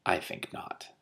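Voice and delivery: breathy whisper, coolly; whispered refusal. Acted. Dragon woman refusing Hrun, whispered. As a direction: whisper it with a knowing smile.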